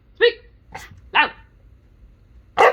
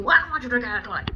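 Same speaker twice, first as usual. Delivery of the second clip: fast, higher scolding mother; lightly voiced mom, quick and firm; 5 semitones higher, 5 dB louder, much faster than usual.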